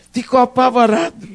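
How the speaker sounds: usual speaking voice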